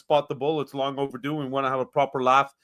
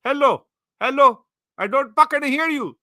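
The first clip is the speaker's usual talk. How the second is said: mock deaf; 8 semitones higher, 8 dB louder than usual. Pretending he can't hear.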